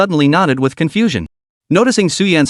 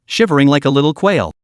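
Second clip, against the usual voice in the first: ordinary delivery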